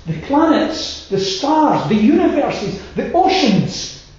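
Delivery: preaching at full force about creation; fiery preaching. Acted. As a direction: build the list up to a full pulpit roar.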